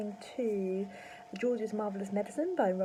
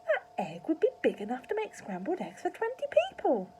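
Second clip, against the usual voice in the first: lightly voiced mother; 8 semitones higher, 4 dB louder than usual. A narrator's reading. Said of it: "Missus Cranky said".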